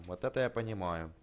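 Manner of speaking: ordinary delivery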